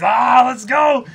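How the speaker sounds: excited yell; hyped shout. Real, not acted. Hype for new chapter.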